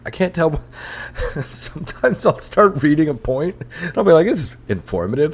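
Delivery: high-pitched, laughing self-mockery; amused confession, voice lifted by laughing; cracking up. Real, not acted. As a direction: talk through real laughter. Cracks up at own ranting.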